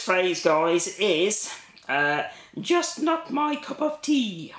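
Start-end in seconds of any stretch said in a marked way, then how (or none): 2.6-4.5 high, slow mock British accent; playful put-on British accent for the tag; best British accent. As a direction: ham up a British accent, pitched up and drawn out. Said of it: "say in your best British accent".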